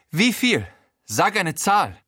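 high, punchy gangster voice; Frankie. A narrator's reading. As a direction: demand a figure cockily. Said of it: "wieder Frankie". (hohe, fordernde Gaunerstimme)

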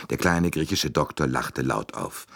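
ordinary delivery, nothing marked

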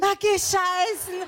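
high, drawn-out mock Viennese accent; imitated Viennese. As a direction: relish it slowly in exaggerated Viennese dialect. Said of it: "was sagen denn die Wiener immer". (übertrieben nachgemachtes, gedehntes Wienerisch)

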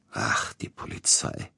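low, slow dismissal with a sigh; scornful, drawn-out, dropped low; contemptuous. A narrator's reading. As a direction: wave it away with weary scorn. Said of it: "machte Peter Iljitsch verächtlich".

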